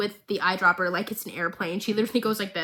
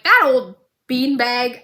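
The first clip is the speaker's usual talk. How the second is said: louder, slow, put-on mocking voice; Jinx's roast; 7 dB louder, slower than usual. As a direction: sneer the insult in a bratty voice. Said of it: "she's like".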